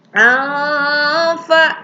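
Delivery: "Alfa" with a long hidden-n hum before "fa"; slow sustained "Alfa", blurred nasal into f; ikhfa recitation. Shown as a demonstration. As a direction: chant "Alfa" high, holding the first syllable. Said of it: "itu adalah ikhfa".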